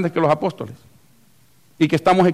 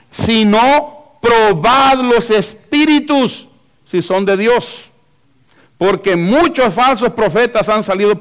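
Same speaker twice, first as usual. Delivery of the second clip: loud, raised, drawn-out Bible reading; emphatic scripture reading; 4 semitones higher, 4 dB louder, slower than usual. Acted. Preacher reading the verse to the congregation, emphatic. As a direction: proclaim the verse forcefully, stretching key words.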